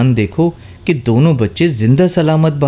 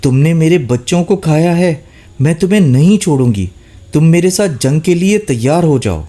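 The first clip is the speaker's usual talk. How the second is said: angry challenge; 6 semitones higher than usual. A narrator's reading. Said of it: "ٹھوکر مار کر بولی".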